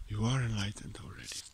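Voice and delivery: breathy, soft, toneless whisper of the same truth. Shown as a demonstration. Teacher whispering the truth to the seeker, in a whisper. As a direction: let the words fall to a hush.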